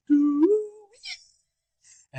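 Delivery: very high vocal imitation of a trumpet; imitated trumpet note. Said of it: "Wayne goes".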